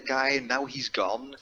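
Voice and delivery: ordinary talk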